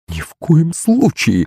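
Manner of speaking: high, slow, disapproving refusal; stern refusal. A narrator's reading. Maman forbids Akulych the servant, slow stern refusal. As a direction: forbid it, drawing the words out. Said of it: "нахмурилась маман".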